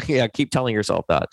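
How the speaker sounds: ordinary delivery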